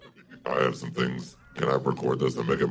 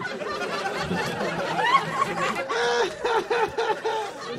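shrill falsetto devil laugh; high devilish cackle. Acted. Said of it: "It's a devil".

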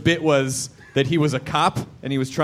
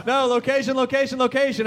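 higher, salesy realtor chant; 7 semitones higher than usual. Pretend. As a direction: sell it like a realtor. Realtor joke.

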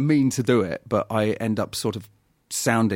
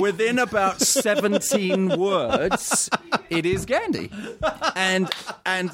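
higher, drawn-out words through laughter; laughing through words; 8 semitones higher, slower than usual. Real, not acted. Actor mocking his own slipping accent, laughing. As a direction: laugh helplessly as you tell it.

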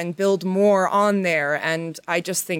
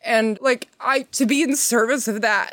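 close to tears; 4 semitones higher than usual. Real, not acted. Honoring her lead actress, on the verge of tears. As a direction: voice pitched up, choked with emotion. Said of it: "God, I'm gonna cry".

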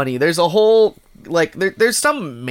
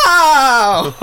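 high, loud laughing yelp of pain; laughing "ow" as if shot; mock pained yelp; 11 semitones higher, 8 dB louder than usual. Dad shot in retold sketch.

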